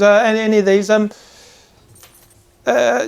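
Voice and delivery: put-on young apprentice showing knives. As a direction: offer the knives uncertainly. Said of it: "He goes".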